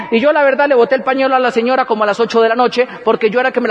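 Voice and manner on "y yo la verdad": ordinary delivery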